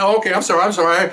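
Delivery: flustered apology. Retold or imitated. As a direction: imitate a flustered man saying sorry. Bleeding man hurriedly apologizes.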